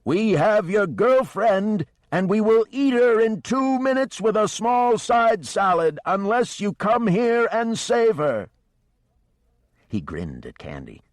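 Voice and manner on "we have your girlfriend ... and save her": high, loud, slow mock threat hollered across the sea; hammed-up operatic holler. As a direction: ham up a joking threat at full voice. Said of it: "he stood there and hollered".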